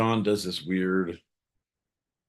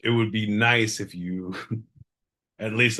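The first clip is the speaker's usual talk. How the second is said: ordinary talk, nothing marked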